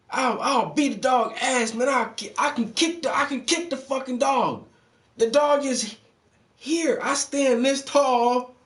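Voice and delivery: high, loud sneering rant at a coward; mocking taunt. Mocking the cop scared of a dog, high taunting rant. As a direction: taunt him like a tough guy laughing at fear.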